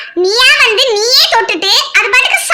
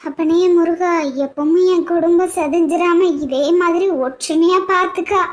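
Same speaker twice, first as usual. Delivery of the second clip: quiet prayer; 5 semitones lower, 3 dB quieter than usual. Acted. Mother prays to Murugan.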